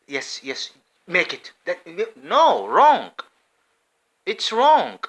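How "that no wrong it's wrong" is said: loud high cry of "wrong"; shouting wrong. Real, not acted. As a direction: shout "wrong" at them, higher and louder. Shouting rejection.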